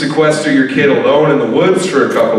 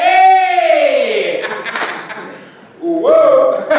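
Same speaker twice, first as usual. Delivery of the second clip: upbeat youth pastor. Pretend. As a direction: shout a hyped welcome like a youth pastor. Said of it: "this is my impression of a youth pastor".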